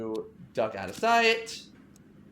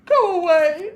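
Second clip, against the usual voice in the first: much higher, much louder furious shout; Clarissa yelling; 11 dB louder, slower than usual. Acted. Clarissa yells at Veronica through the door, furious. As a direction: scream it at the woman outside your door.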